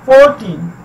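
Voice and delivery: Fourteen with FOUR punched; FOUR-teen, first syllable strong and falling after in fourteen; stress on FOUR. Shown as a demonstration. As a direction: lean on the start of fourteen. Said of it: "four पे stress किया जाता है".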